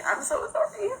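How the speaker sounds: a sob catching the words; apology breaking up with crying; voice breaking into tears. Real, not acted. Tearful apology while crying.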